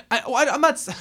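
high, loud burst breaking into laughter; helpless laughing as you object; laughing protest. Real, not acted. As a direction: crack up mid-protest, voice shooting up. Cracks up while protesting.

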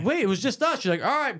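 high, lightly voiced back-and-forth; mock innocent voices. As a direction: play it off innocently. Imagined exchange voiced.

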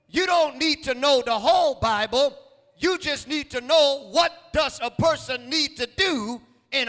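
rising, insistent preacher cadence; preaching. Acted. Preacher urging the church to teach, insistent. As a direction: press it on the congregation.

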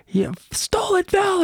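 very high, strained retold scream; mock scream. Blond kid accusing the man in camo on the train, mock screaming. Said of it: "he's just screaming".

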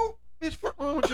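high, cracking laugh-shout of disbelief; laughing shock. Real, not acted. Friend reacts to a stalker guess with high laughing outrage.

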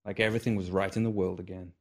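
plain reading voice

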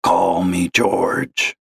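slow gravelly voice; a smoker's gravelly drawl on the phone; gravelly old caller. Acted. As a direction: drag it out like a heavy smoker. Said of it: "gravelly voice like that".

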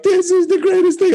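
put-on high, giddy drunken cheer; excited drunk cheer. Drunk partiers cheering in mud.

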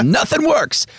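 high-pitched mock whine of frustration; 자기 흉내 징징거림. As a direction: squeal it like a hammed-up helpless complainer. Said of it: "where I'm all".

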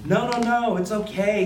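high-pitched, insistent reassurance; hurried reassurance. A narrator's reading. Banker tells the sick-spattered narrator it's fine, high reassurance. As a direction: pitch it up high to wave off the apology.

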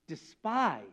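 Despise slow and falling, low and soft; stressed despise. Shown as a demonstration. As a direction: let Despise sink heavily. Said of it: "that's a strong word".

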